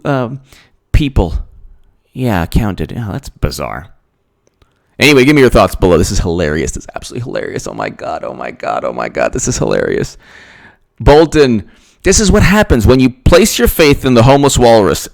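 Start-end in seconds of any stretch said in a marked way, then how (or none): 6.0-10.2 amused. Real, not acted. Quiet laughing about the news.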